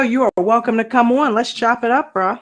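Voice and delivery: ordinary delivery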